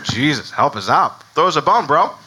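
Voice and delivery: comic, modern-slang pleading; mock desperation. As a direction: plead like an exasperated buddy. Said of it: "this desperation of, like".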